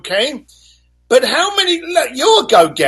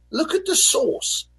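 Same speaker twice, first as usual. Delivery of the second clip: normal talking voice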